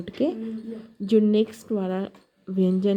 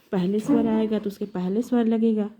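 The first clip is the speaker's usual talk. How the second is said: normal talking voice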